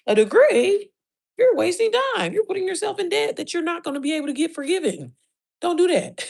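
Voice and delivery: high, loud, incredulous rant; worked-up rant. Real, not acted. Warning viewers off college debt, high and loud. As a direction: pitch it up in outrage. Ranting against college degrees.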